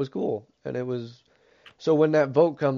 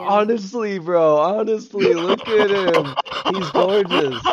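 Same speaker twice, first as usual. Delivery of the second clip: excited teasing; 5 semitones higher, 6 dB louder, slower than usual. Acted. Teasing praise, loud.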